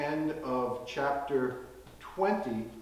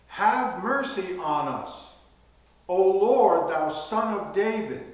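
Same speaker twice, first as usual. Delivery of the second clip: high, loud plea for mercy; crying out for mercy; 7 semitones higher, 8 dB louder than usual. Retold or imitated. Blind men crying out loud to Jesus for mercy. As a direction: call out loud and begging to the Lord. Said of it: "cried out".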